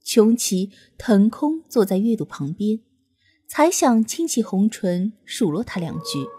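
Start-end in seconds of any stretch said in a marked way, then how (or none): none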